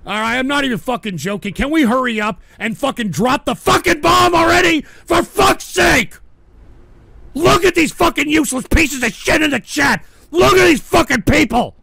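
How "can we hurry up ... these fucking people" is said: screaming in rage, higher and louder; real furious yelling; furious shout. Real, not acted. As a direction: yell abuse at the chat. Raging at chat.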